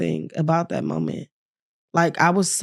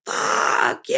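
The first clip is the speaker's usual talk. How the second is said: angry curse. Retold or imitated. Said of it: "I was just so angry".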